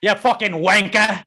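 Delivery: loud, slow British insult; mock British shout. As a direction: ham up a cockney curse. Joining British parody.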